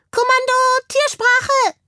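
tiny commander calling out very high; high-voiced hamster command. A narrator's reading. Hamster commanding animal talk, high-voiced call. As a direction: squeak out a crisp order to the squad. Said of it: "mit einer hellen Stimme".

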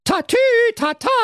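very high, loud siren call; siren imitation. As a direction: shout it like a siren. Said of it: "kurbelte sie die Scheibe herunter und rief".

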